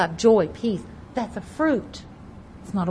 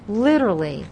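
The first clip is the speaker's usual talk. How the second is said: usual speaking voice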